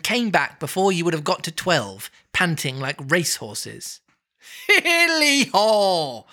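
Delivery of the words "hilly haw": slow, high, loud Welsh whoop; jovial Welsh cry. Acted. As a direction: cry out merrily. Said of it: "cried old Fezziwig".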